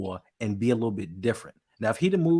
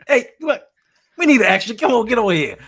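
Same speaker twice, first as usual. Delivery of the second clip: hollered call; 11 semitones higher, 10 dB louder than usual. Retold or imitated. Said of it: "hoping they'd be like".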